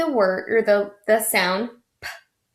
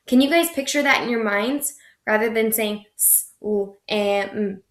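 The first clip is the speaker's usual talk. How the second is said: usual speaking voice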